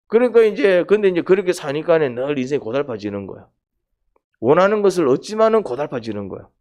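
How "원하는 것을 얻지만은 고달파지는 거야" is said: ordinary talk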